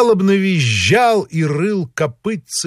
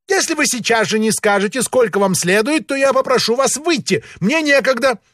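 high, quick, impatient official; haughty high-pitched impatience; peacock voice; 9 semitones higher than usual. A narrator's reading. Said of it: "сказал он павлиньим голосом".

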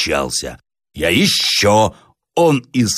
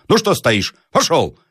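higher, impatient command to leave; angry order; 8 semitones higher than usual. A narrator's reading. Landowner ordering the village elder out, angry. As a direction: shoo him out in a temper.

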